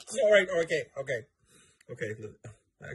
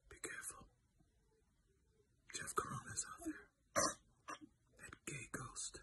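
spooky whisper; 15 dB quieter than usual. Pretend. Warning the friend about the ghost, spooky whisper.

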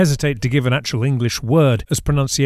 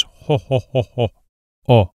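a light Father Christmas "ho ho ho ho"; ho-ho like Santa, showing the O vowel; lightly voiced Santa laugh; Santa Claus laugh; 4 dB louder than usual. Shown as a demonstration. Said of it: "that of Santa Claus, Father Christmas".

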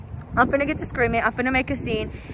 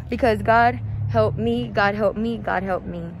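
ordinary talk

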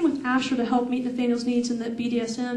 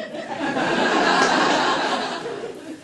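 airy sigh-laugh; 5 semitones higher than usual. Real, not acted. Reader sighs and laughs.

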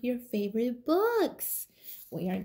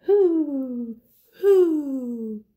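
loud, round hoot; owl hoot; 3 semitones higher, 6 dB louder than usual. Acted. Owl hoots.

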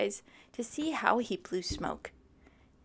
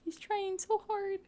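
tender aside; 8 semitones higher, 6 dB quieter than usual. Real, not acted. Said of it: "sympathetic aside at the picture".

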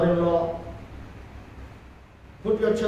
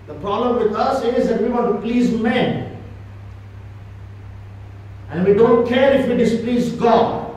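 loud, rising sermon climax; fervent revival preaching, louder and higher; preaching at full force; 5 semitones higher, 4 dB louder than usual. Acted. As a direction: drive it home to the congregation.

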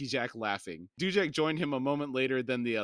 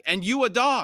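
high, loud retort; shouting back; 10 semitones higher, 7 dB louder than usual. A narrator's reading. Krupp answers Crone's jibe, shouting back. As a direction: fling it back at the top of the voice. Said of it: "He shouted back".

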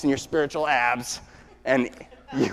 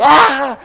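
mock cry of pain; 13 semitones higher, 13 dB louder than usual. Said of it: "he's like".

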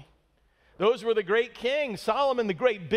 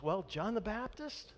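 tentative answer, voice rising on BAPTIST; questioning inflection; 9 dB quieter than usual. Shown as a demonstration. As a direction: softly lift BAPTIST into a question. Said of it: "the inflection in your voice".

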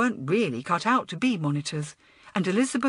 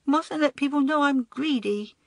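put-on high-pitched, upset little boy; small boy guarding a secret; muttering small boy; 7 semitones higher than usual. A narrator's reading. Tearful boy confides in older girl, upset. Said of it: "he muttered".

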